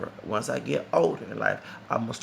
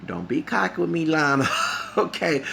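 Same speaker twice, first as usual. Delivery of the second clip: louder, higher, laughing warning; teasing scold; 5 semitones higher, 4 dB louder than usual. Real, not acted. Telling Lionel off at the concert, playful and laughing. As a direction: wag a finger with a laugh.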